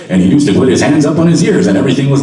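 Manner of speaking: old-time radio announcer impression, loud; Gary Owens. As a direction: imitate a big vintage commercial voice. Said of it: "who used to talk like this".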